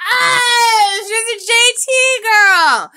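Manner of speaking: put-on "she's a J T girl" cried out high and loud; excited imitation. Host exclaiming about his wife's crush, high excited shout. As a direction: yell it like the host catching on with glee.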